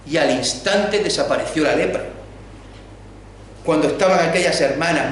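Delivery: ordinary talk, nothing marked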